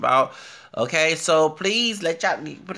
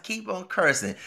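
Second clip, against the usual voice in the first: higher-pitched urging; egging him on; 6 semitones higher than usual. Retold or imitated. Vlogger retelling what they told the newscaster, egging him on. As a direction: cheer on the cursing. Retelling own reaction, first take.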